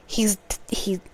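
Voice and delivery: a retold friend's broken whisper, barely voiced. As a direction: breathe it out, unable to finish. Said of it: "she said".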